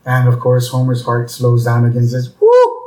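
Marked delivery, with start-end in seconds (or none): 2.4-2.8 loud, high mock whew; relieved whoop. Worker's heart slowing after praise, exaggerated high relieved whoo.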